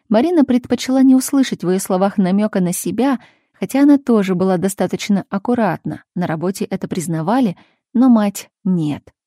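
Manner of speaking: ordinary delivery, nothing marked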